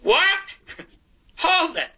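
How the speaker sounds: high-pitched interrupting holler; retold holler. Storyteller interrupting the young comic's opening joke, hollering. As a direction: cut in with a high, mock holler. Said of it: "And I holler".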